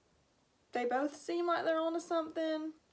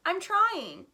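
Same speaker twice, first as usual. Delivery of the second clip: earnest I'm trying; 6 semitones higher, 6 dB louder than usual. Acted. Haley answers ancestors.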